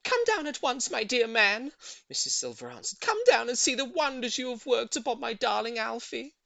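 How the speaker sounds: high, loud, thrilled; delighted lady. A narrator's reading. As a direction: call him down joyfully. Voices Missus Silver.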